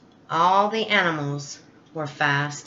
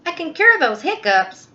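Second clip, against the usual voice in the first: higher, louder excited zebra; 10 semitones higher, 5 dB louder than usual. Acted. Zebra offers a cure to Elephant, higher and louder. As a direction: pitch it up bright and eager. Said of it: "Zebra said".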